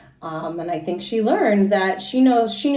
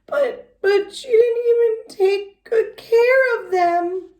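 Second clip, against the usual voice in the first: high, slow, crying complaint; tearful whine; 13 semitones higher, 3 dB louder, slower than usual. Acted. Pink fluffy girl whining tearfully to the teacher. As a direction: whimper it like a caught child. Girl accused, about to cry.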